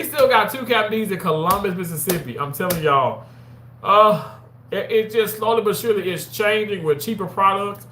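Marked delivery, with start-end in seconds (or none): none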